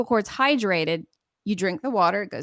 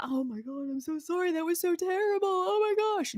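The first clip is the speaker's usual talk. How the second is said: embarrassed apology; 9 semitones higher, 5 dB quieter than usual. Retold or imitated. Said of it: "You're like".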